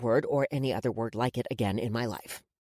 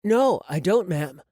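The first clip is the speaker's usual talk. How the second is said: feigned innocence; 3 semitones higher, 6 dB louder, slower than usual. A narrator's reading. Boy pretending innocence to a stern woman, feigned innocent. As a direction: play innocent, voice lifted. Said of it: "pretending to be innocent".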